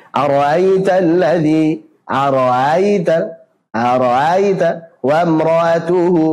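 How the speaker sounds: Ara'aita slow, every hamzah distinct; clear hamzah. Shown as a demonstration. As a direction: spell out each hamzah in ara'aita. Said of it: "Harusnya jelas".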